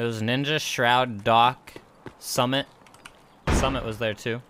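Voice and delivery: usual speaking voice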